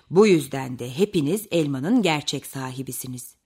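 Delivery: low storybook bear voice; deep-voiced bear settling a dispute; Tonton bear judging. A narrator's reading. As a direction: pronounce the verdict in a deep voice.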